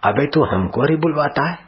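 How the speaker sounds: high, jeering soldier taunt; mocking guard; scornful soldier. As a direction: sneer at him from above. Soldier mocks the beaten boy.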